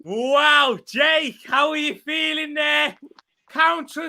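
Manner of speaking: high, loud thrilled calling; excited shouting. Real, not acted. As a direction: keep the goal buzz going. Still celebrating the goal live.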